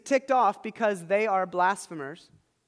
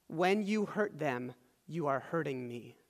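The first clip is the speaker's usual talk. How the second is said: slow, dropping softer and lower; grave, deliberate; 5 semitones lower, 7 dB quieter than usual. Acted. Jesus explains to Saul whom he hurts, slow and grave. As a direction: spell it out slowly, letting the voice sink.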